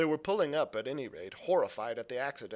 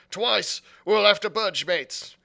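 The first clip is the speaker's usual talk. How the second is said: higher, louder alarmed call; alarmed pirate; 6 semitones higher, 9 dB louder than usual. A narrator's reading. Pirate warning his mates to flee, loud. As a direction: warn the mates to move. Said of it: "said one".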